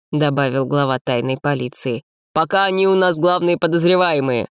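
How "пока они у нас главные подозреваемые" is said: higher, firm police chief; stern official. A narrator's reading. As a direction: state it as the chief suspect list. Mayne voiced in story.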